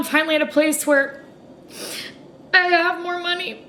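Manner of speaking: speaking through tears; tearful. Real, not acted.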